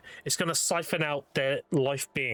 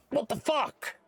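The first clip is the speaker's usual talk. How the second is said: shocked goddess; 8 semitones higher, slower than usual. Retold or imitated. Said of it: "she's like".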